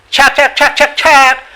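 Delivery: high, rapid-fire chomping syllables; imitated shark noise. Shown as a demonstration. Teacher inventing a shark's sound for listeners, high staccato imitation. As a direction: chant a made-up shark call in sharp bursts. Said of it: "it doesn't mean shark voice".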